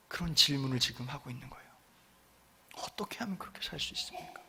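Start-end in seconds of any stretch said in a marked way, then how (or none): 2.8-4.4 whispered, awed question. Retold or imitated. Jailer asking how they could live so, hushed whisper. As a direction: breathe the question in wonder.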